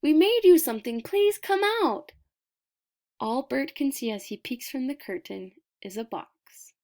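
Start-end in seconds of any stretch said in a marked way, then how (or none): 0.0-2.1 put-on higher and louder, a small boy calling through the door; a little boy calling his friend out to play, higher and louder. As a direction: call out eagerly like a tiny boy at the door. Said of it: "He hears Shu's voice".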